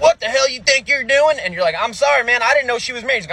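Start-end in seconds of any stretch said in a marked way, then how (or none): 0.0-1.4 high, pushed husband voice; husband catching the comic in bed, a high barked challenge; angry husband. Retold or imitated. 1.8-3.2 panicked apology. Retold or imitated.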